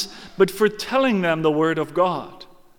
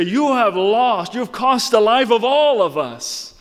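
blaming shout; 6 semitones higher, 7 dB louder than usual. Acted. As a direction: hurl the blame at him. People blaming the watchman.

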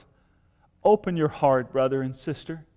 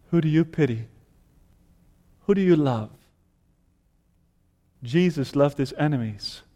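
ordinary talk

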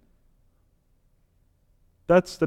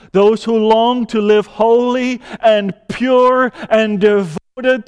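raised, louder, higher pulpit voice; impassioned preaching; 6 semitones higher, 5 dB louder than usual. Real, not acted. Preacher urging God's people toward holy living, impassioned. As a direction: preach it at full force, building in pitch and volume.

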